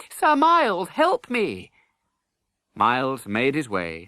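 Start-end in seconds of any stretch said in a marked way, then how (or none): 0.1-1.7 high, louder call for rescue; crying out. Acted. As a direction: call to Miles over the crowd. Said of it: "he cried".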